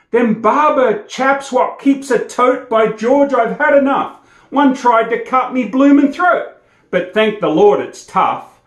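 bragging bushman. Acted. Bushman brags to the shearers.

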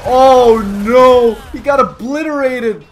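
high, loud dismayed cry; mock horror. Real, not acted. Onlooker seeing a player obliterated, loud mock horror. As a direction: cry out as he gets wrecked.

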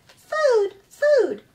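high, loud little chick cry; peeping gosling. Acted. Newborn gosling calling to the robot, high peeping cry. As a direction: peep it out like a hungry baby bird.

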